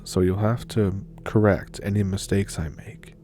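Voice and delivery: usual speaking voice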